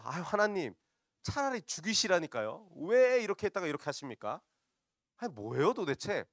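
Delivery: high, loud exasperated protest; acted indignant outburst; rough complaining. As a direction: pour out grievances at full pitch. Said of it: "하나님한테 아주 거칠게 불평을 하거든요".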